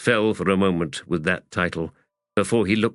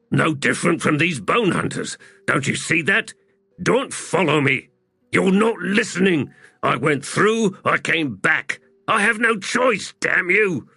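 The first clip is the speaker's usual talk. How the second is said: much higher, heated pleading; desperate angry outburst; 10 semitones higher than usual. Acted. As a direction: argue desperately, building to a curse. Quarrel peaks, tears in eyes.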